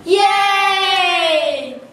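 high, loud sung cheer. Acted. Peasant cheering the end of taxes, joyful cheer. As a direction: celebrate the victory.

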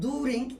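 "During" as "doo-rink" with a hard k ending; wrong during, oo vowel. Shown as a demonstration. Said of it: "İkisi de değil".

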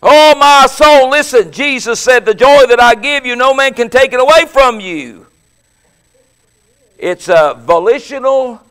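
full-voiced sermon delivery; preaching. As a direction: preach it out to the congregation. Preaching with force.

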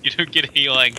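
loud refusal; shouting. Pretend. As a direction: bark it out. Yelling at the character.

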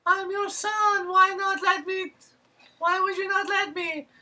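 very high, loud, slow falsetto whine; mock whining son. Son protesting to his mother as he runs away, loud falsetto whine. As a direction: wail it high like a spoiled son. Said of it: "he runs away and he's like".

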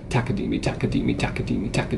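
"Takadimi" with the first syllable TA accented; stress on TA. Shown as a demonstration. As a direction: chant TAkadimi evenly, punching "Ta" each time. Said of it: "if you accent the first note".

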